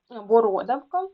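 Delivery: бородавка stressed on РО; stress on second syllable. Shown as a demonstration. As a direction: say боРОдавка, leaning hard on РО. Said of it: "наголос на другий склад".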